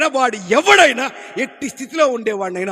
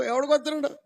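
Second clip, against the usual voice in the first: ordinary delivery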